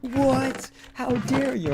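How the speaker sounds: high, loud mock-offended gasp; mock outrage. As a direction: play the boss hamming up her shock. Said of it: "she was like".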